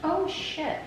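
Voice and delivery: sudden high yelp of surprise; surprised. Real, not acted. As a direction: blurt it out, caught off guard. Finds mess on fridge.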